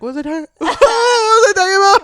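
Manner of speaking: high, loud, fast laughing shout; hammed-up lost train of thought; mock confusion. Imagines him losing his train.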